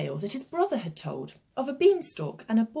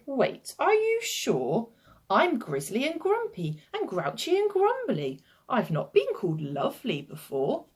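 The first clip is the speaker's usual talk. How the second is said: astonished grumpy giant; 4 semitones higher than usual. Acted.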